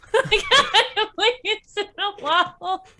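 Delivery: high, slow, laughed-through repeat of the line; laughing echo. Real, not acted. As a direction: giggle the question back. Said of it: "laughs repeating".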